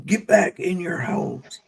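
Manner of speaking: mock police yell. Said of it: "outside yelling".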